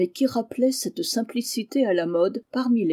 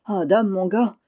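ordinary delivery, nothing marked

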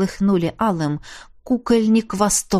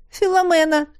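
worried call; 8 semitones higher than usual. Acted. Maid finds her mistress.

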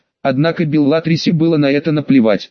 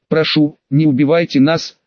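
usual speaking voice

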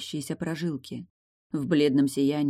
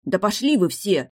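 louder, irritated lad's retort; snapping back; 4 semitones higher, 5 dB louder than usual. A narrator's reading. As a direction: snap at them all. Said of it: "огрызнулся парень".

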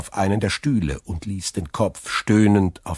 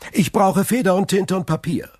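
much higher, louder order to the waiter; commanding; 6 dB louder than usual. Acted. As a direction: bark the order. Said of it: "befahl er".

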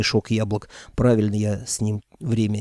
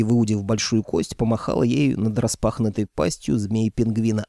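plain reading voice

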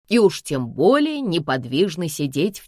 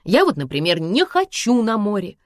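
ordinary delivery, nothing marked

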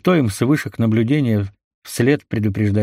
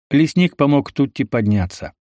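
ordinary delivery, nothing marked

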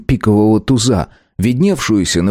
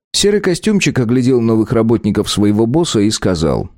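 ordinary delivery

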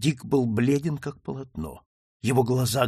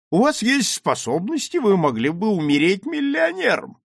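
high-pitched, emphatic character voice; high, insistent old-officer sermon; lecturing admiral; 9 semitones higher, 5 dB louder than usual. Acted. Admiral lectures the young man.

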